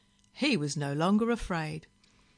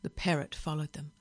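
plain reading voice

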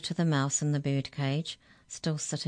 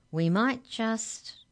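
high, drawn-out, suggestive; coaxing offer; 7 semitones higher, 3 dB louder, much slower than usual. A narrator's reading. Molly bargaining for the money, coaxing. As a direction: dangle the offer slowly.

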